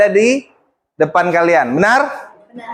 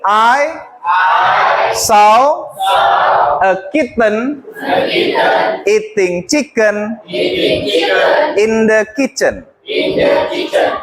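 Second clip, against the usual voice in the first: slow model reading; 2 semitones higher, much slower than usual. Shown as a demonstration. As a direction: chop the tongue twister slowly, phrase by phrase. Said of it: "I want you to repeat after me".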